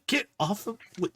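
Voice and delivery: high, cross little voice; a small bot shrugging off a clingy pet; annoyed robot. Acted. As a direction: shove the words off, irritated. Aegis shaking off Scamper.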